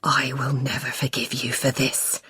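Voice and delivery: soft, low, menacing growl. Acted. Husband threatening his wife, low whispered growl. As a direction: growl it under the breath. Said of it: "he growls".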